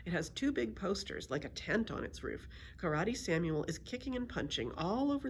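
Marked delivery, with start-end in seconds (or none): none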